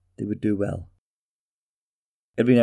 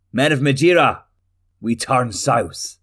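ringing order to the troops, louder and higher; commanding call; 4 semitones higher, 6 dB louder than usual. Acted. King Eldir calls his army south, a loud ringing command. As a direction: call the army to march, slowing on the last words.

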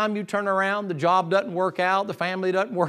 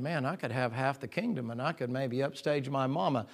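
hushed, low musing; soft, low inner monologue; thinking aloud; 6 semitones lower, 11 dB quieter than usual. Acted. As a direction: voice a scheming thought under your breath. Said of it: "And I'm thinking".

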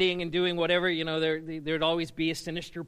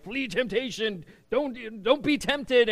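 higher-pitched mock admonishing; mock church warning; 4 semitones higher, slower than usual. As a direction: parody an urgent church warning. Acting out church's warnings about temptation.

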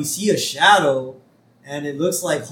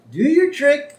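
high, louder coaxing voice; coaxing Burns; 12 semitones higher, 5 dB louder than usual. Retold or imitated. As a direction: egg the dog on to perform. Burns coaxing his puppy.

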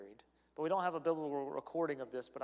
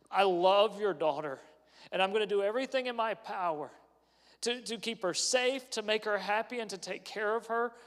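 earnest vow; 5 semitones higher, 7 dB louder than usual. Retold or imitated. Voicing himself to his in-laws.

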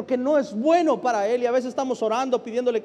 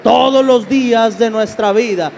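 full-force preaching; 5 semitones higher, 10 dB louder than usual. Acted. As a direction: preach it building to a ringing shout.

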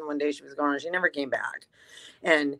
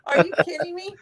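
much higher pitched disbelief; a pitched-up, hammed-up shocked cry; retold shock; 13 semitones higher than usual. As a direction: squeal it in disbelief. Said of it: "I'm like".

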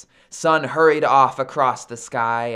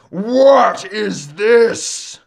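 slow, loud, high-pitched roar; 7 semitones higher, 8 dB louder, much slower than usual. Acted. Captured Sun roars at the snare, loud drawn-out roar. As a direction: bellow it out, stretching every word. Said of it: "roared Sun".

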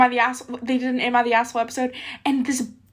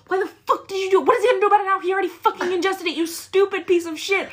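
high, fast outraged swearing; swearing tirade; 8 semitones higher than usual. Real, not acted. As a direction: yell in disgust at a friend. Outraged at disgusting prank.

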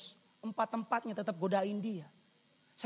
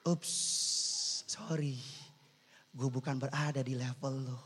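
low, soft acted brush-off; quality man declining; 6 semitones lower, 6 dB quieter than usual. Quality guy rejecting a woman, low soft dismissive voice. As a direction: drop your voice and coolly turn someone down. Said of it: "dia akan bilang".

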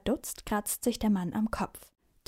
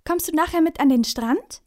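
beaming; 8 semitones higher, 6 dB louder than usual. A narrator's reading. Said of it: "strahlt Finn".

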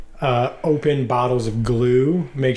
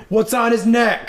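demanding; 6 semitones higher than usual. Acted. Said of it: "be like".

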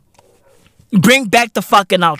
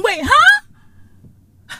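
high, baffled question; mock confusion. Rant to fans, acting baffled by the memory. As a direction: play dumb, pitch way up.

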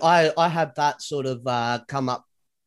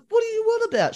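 high-pitched mock bafflement; baffled; much faster than usual. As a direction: retort in exaggerated disbelief, voice shooting up. Acted baffled reply to wife.

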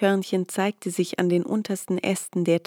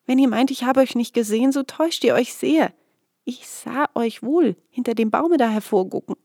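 shaky breathless pleading; 5 semitones higher, 4 dB louder than usual. A narrator's reading. Peter pleads with hidden Glasmann.

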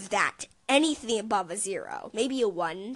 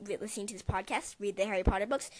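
usual speaking voice

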